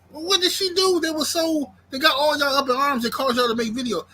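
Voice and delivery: high, puzzled retold reaction; baffled questioning. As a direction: ask it in high-pitched disbelief. Retold puzzled question after watching it.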